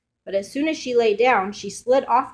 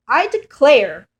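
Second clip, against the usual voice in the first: higher, louder, slow exclaim; Mama Bear exclaims after Goldilocks flees; 5 semitones higher, 8 dB louder, slower than usual. Acted. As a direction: declare it in dismay. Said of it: "Mama Bear exclaimed".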